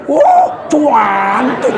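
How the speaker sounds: very slow, drawn-out Javanese "cantik" with a laugh; mock Javanese emphatic "cantik"; Javanese emphasis. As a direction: stretch the praise like a proud Javanese husband. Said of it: "Kalau istrimu cantik".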